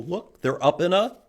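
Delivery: plain reading voice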